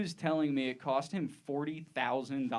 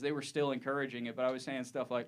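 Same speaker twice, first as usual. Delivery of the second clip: usual speaking voice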